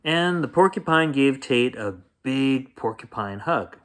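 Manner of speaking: ordinary delivery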